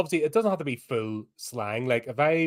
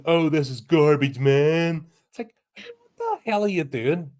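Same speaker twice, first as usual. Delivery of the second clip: louder mock Southern Californian YouTuber; 4 semitones higher, 3 dB louder than usual. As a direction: scornfully imitate Southern Californian talk. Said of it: "friggin' Southern Californian twat".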